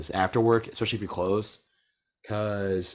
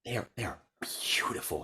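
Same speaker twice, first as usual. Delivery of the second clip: hushed, breathy whisper of awe; can't-believe-it whisper; 8 dB quieter than usual. Real, not acted. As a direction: whisper it like a secret wonder. Awed about beautiful women.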